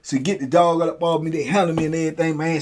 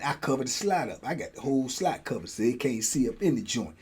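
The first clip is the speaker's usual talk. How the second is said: usual speaking voice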